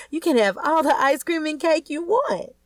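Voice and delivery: put-on high, eager offer to the kids; bright generous answer. A narrator's reading. New wife promising the kids treats, lightly voiced eager. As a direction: say it like a too-eager new stepmom.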